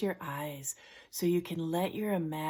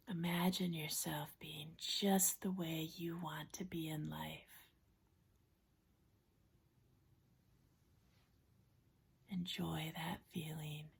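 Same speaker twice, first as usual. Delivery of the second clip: soft, unhurried relaxation-coach voice with long pauses; guided imagery; 5 dB quieter than usual. Acted. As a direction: gently guide them into a safe place, hushed and calm. Leads a guided imagery exercise.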